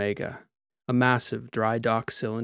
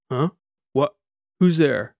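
woken with a louder jolt; startled cyborg; 4 semitones higher, 8 dB louder than usual. A narrator's reading. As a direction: blurt it, just woken up. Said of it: "the cyborg said".

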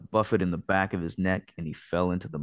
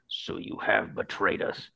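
thick bitter cry; 2 semitones higher than usual. Acted. Hawkmoon accuses De Verck of betrayal, thick and slow. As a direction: choke it out, betrayed. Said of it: "Thickly, Hawkmoon cried".

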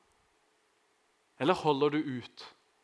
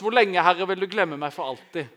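raised, louder psalmist's plea to God; crying out; 3 semitones higher, 8 dB louder than usual. Retold or imitated. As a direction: call out loudly as David pleading with God. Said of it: "Så roper han først". (ropende, høylytt bønn)

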